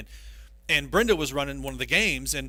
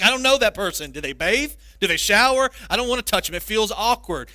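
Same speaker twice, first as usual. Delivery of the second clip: louder, higher squeamish protest; reluctant congregant; 5 semitones higher, 5 dB louder than usual. Retold or imitated. Preacher voicing a churchgoer dodging touching neighbor, squeamish. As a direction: whine like you won't touch a stranger.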